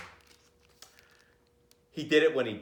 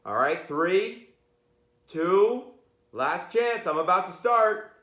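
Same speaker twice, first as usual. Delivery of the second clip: building countdown, higher and louder; playful countdown; 6 semitones higher, 3 dB louder, slower than usual. Pretend. Teacher counting down to opening the book, playfully excited. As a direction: count them down like a launch. Mock countdown before reading.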